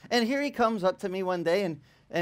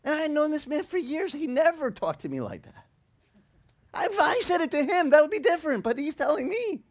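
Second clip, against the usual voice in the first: high, whiny incredulous complaint; indignant protest; 10 semitones higher, 3 dB louder than usual. Retold or imitated. Pastor protesting to God about his friend, high-pitched indignation. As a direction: argue it hotly in a raised pitch.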